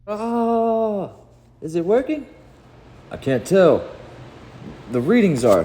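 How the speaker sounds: very high, anxious checking; nervous, breathless urgency; tense excitement. Acted. Heroes watching if the blast works, tense and excited.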